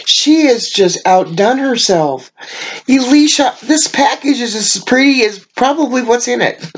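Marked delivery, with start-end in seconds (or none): none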